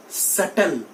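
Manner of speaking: "subtle" as SUT-tl; silent b. Shown as a demonstration. Teacher has students say it along, b silent. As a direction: model "subtle" without any b. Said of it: "Try to say it with me".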